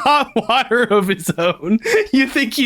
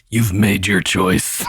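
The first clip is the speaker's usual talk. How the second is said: very low, slow pronouncement; talking hat; 12 semitones lower, slower than usual. Acted. Enchanted hat as the shop shuts, deep slow verdict. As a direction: intone it deep and ominous. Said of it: "you hear the hat go".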